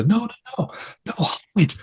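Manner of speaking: frightened whisper, pleading; resistance whisper. Retold or imitated. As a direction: whisper as if hiding from danger. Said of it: "he starts to whisper".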